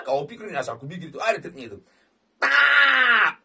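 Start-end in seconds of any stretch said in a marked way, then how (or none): 2.4-3.4 very high, loud bark; excited shout. Real, not acted. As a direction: shout the tap. Tapping for the bonus. (teriakan heboh)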